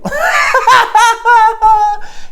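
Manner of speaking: high, loud-edged cackle. Acted. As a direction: cackle at your own punchline. Laughs at own punchline.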